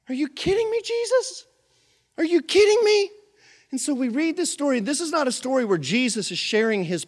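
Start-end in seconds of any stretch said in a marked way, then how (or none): 0.1-3.1 high-pitched disbelief; incredulous outburst. Real, not acted. Preacher reacting to Jesus's question, incredulous. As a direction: throw up your hands in disbelief. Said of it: "preacher's disbelief".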